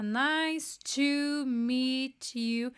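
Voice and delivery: "Nice to meet you" drawn out word by word, "to" as "tchu"; very slow model phrase. Shown as a demonstration. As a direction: spell out "Nice to meet you" very slowly, syllable by syllable. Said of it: "Aqui tá em português".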